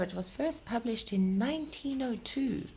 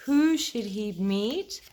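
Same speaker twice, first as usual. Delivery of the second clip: put-on gentle, lilting read-aloud to children; storybook reading. Reading Peter Rabbit aloud to the children, gentle storytelling voice.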